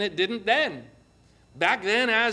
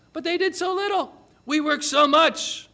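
high-pitched, aggrieved whine of injustice; shouted protest; 9 semitones higher than usual. Acted. Bitter workers comparing themselves to latecomers, indignant protest. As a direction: plead your case high and indignant. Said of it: "they shouted".

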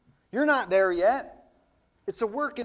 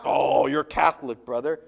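lower, louder dismissive voice; mock churchgoer; 6 semitones lower, 4 dB louder than usual. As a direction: scoff like a smug church member. Mocking churchgoers' objection.

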